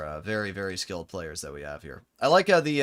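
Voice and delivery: ordinary talk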